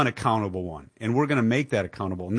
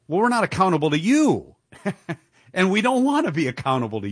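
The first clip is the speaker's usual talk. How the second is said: raised, louder indignant retort; 6 semitones higher, 3 dB louder than usual. Real, not acted. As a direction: fire it back indignantly. Retorting to politician, loud.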